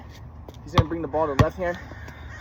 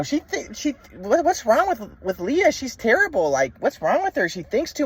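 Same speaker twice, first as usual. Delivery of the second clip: raised, higher-pitched mom, puzzled and critical; mom's voice; 7 semitones higher, slower than usual. Retold or imitated. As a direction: imitate his mom complaining about Leah. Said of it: "my mom was like".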